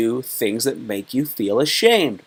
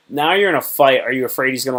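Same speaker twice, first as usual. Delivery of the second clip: ordinary talk, nothing marked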